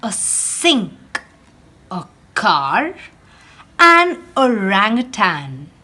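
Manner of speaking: lively storybook read-aloud for a class; animated picture-book reading; reading to children. Acted. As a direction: read it like storytime for little ones. Teacher reads picture book aloud.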